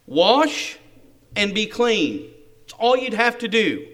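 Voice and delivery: loud, punched "Wash" and "be clean"; emphatic reasoning. Acted. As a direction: drive home how simple it is. Servant reasons emphatically with master.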